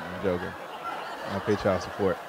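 usual speaking voice

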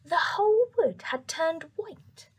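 usual speaking voice

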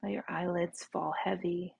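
soft, unhurried meditation voice; guided meditation. Real, not acted. Teacher leading listeners to relax in the right now, soft meditation voice. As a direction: guide them gently into the body, with long pauses.

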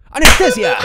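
loud comic shout; sudden yell. Acted. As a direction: yell it out like a battle cry. Surgeon starts operation with a yell.

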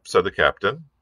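usual speaking voice

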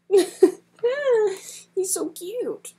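high, slow gushing coo. Real, not acted. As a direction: coo over how cute he is. Gushing over a compliment.

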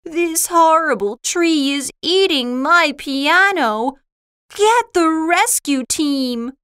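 high, alarmed calling out; urgent shout. A narrator's reading. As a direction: call frantically for rescue. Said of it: "shouted Schroeder".

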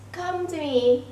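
ordinary delivery

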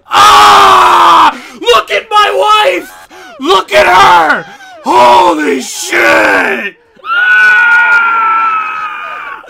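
gasping, overwhelmed yelling; breathless screaming. Real, not acted. Streamer overwhelmed by the announcement, screaming. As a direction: shout like you can't catch your breath.

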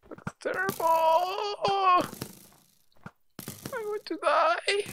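very high, soft wail; high dread whine. Real, not acted. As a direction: draw it out in a tiny high whimper. Dreads the jump in game.

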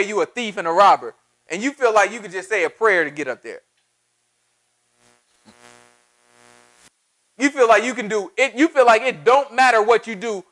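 fired-up preacher challenging the congregation; insistent, emphatic sermon delivery; forceful preaching. Real, not acted. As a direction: press each accusation home with punched key words.

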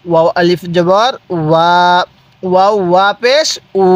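measured spelling chant with "ওয়া" held long; lilting letter names, level long "উ"; drawn-out vowels. Shown as a demonstration. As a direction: spell it out and stretch "উ". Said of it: "কিভাবে?".

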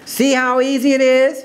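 high, show-off exclamation; showing off to the crowd, very high; exclaiming. Acted. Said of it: "she exclaimed".

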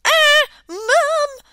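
high, loud panicked scream for Mum. A narrator's reading. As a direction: shriek for Mum in a fright. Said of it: "screamed Smook".

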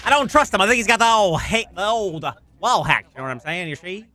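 ordinary talk

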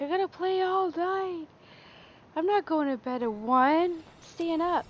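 mock yourself gearing up; hyped-up self quote. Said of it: "I'm like".

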